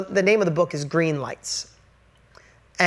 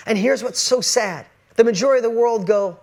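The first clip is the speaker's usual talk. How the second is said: ordinary delivery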